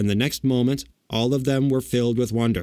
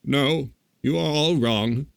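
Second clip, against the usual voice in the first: slow, higher, meek admission; 4 semitones higher, slower than usual. A narrator's reading. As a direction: correct them meekly, taking your time. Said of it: "said the little man meekly".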